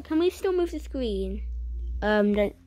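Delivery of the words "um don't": usual speaking voice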